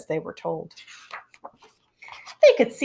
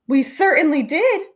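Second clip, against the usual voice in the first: cried out; 6 semitones higher, 4 dB louder than usual. Acted. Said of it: "cried all the kids".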